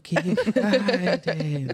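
high, drawn-out ghostly announcement; playful spooky voice. Pretend. Host announcing Spooky Friday segment, playful spooky voice. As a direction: wail it slowly in mock-eerie fun. Co-hosts chant segment title.

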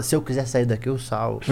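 low, soft mumbled threat; mumbled drunk boast. Retold or imitated. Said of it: "Ele fala". (fala baixo e arrastado como um bêbado)

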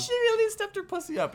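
high, loud campy diva voice; campy catchphrase. Pretend. Co-host hamming up a catchphrase for the show, high diva voice. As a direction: belt it like a drag queen.

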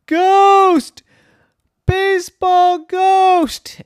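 very high, loud alarmed shout; scared cry. A narrator's reading. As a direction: shriek a warning like a frightened child. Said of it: "then she got scared".

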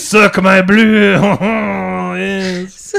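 drawn-out cartoon French accent; mock French accent. French lover talking dirty to the girl, mock French accent. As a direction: ham up a lecherous Frenchman, stretching the line ends. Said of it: "like, in French".

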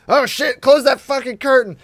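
loud, high alarmed outburst; mock panicked shout. Mocking the exposed rich, shouting in alarm. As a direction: yell it like caught exposed.

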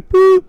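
high, loud boop; short high mock beep; imitated voicemail tone. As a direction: make a loud answering-machine boop.

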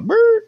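imitated engine rumble; engine noise. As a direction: mimic a car pulling up. Car pulling up sound.

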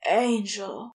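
a loud cry of "Angel!"; cried out. Acted. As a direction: call it out, overwhelmed. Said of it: "Crowley cried".

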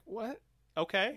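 a high, baffled "what?" trailing into a flat okay. Retold or imitated. Reader baffled by an agent's first draft, high disbelief. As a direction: pitch jumps up in disbelief, then resigned. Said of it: "I was like".

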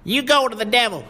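put-on high, loud angry shout in a boy's voice; enraged boy yelling at the driver; furious cry. A narrator's reading. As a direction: lash out at the man. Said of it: "he cried".

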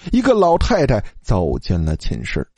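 usual speaking voice

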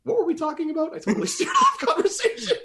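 high, squeaky words through laughter; helpless high-pitched giggling speech; laughing. Real, not acted. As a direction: barely get it out while cracking up. Cracking up at losing track.

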